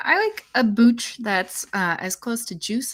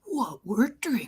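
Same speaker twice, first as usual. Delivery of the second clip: whispering; 4 dB quieter than usual. Pretend. Echoing the segment name.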